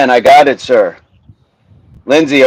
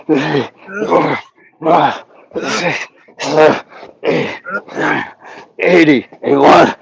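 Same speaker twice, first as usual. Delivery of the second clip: straining count; 2 semitones higher than usual. Real, not acted. Coach finishing reps, counting with building strain.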